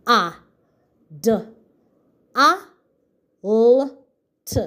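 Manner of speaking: adult sounded out as separate sounds A, D, A, L, T; sounding out. Shown as a demonstration. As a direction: break adult into its sounds one at a time. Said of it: "say the sounds in the word".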